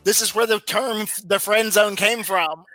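ordinary delivery

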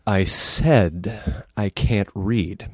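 very slow mock grandeur; pompous illiterate. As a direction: drag it out, grandly self-important. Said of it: "my impression of a pompous illiterate".

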